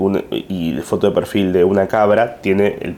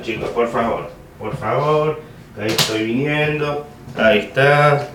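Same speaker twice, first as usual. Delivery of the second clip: far off, softer, higher calls; distant voice asking the audience to wait; calling from off mic; 3 semitones higher, 4 dB quieter than usual. Real, not acted. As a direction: shout back from across the room. Calls from away fetching phone.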